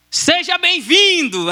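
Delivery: high, drawn-out shout of welcome; stretched-out, celebratory call. Retold or imitated. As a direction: imitate kids yelling a greeting. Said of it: "os outros pivetes gritando assim".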